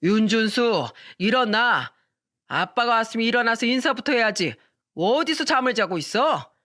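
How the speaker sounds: put-on drunken father yelling at sleeping son. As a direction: shout him awake, slurring a little. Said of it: "소리치면서 말했어요".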